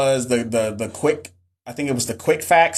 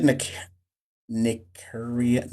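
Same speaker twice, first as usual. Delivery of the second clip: "Nikuria" slow and soft, syllable by syllable; sounding out; 7 dB quieter, much slower than usual. Real, not acted. Reading a viewer's unfamiliar name, sounding it out. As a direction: break "Nikuria" into careful, quiet pieces.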